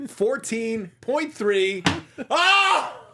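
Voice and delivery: slow, tense, then a loud, high cry of relief; tense drawn-out reveal. Real, not acted. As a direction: stretch each number, then burst out loud. His loss is revealed, tense.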